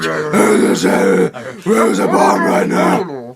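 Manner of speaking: slow, loud growling Batman impression. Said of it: "It was like".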